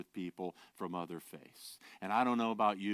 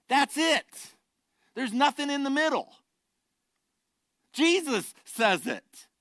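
full-force preaching; 13 semitones higher, 9 dB louder than usual. Acted. Pastor warning the congregation, loud preaching. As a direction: hammer there's nothing in the middle.

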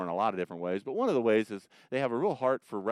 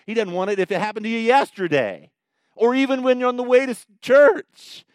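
much higher and much louder, building to a cry on church; preaching climax; 11 semitones higher, 13 dB louder than usual. Real, not acted. Preacher peaks on a point.

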